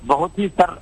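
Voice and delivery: normal talking voice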